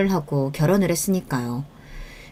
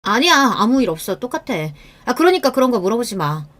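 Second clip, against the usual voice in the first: higher, irritated brush-off; defensive denial; 4 semitones higher than usual. Acted. Wife refusing husband's questions, defensive and annoyed. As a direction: deny it and shut the question down. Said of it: "그걸 인정하지 않으려고".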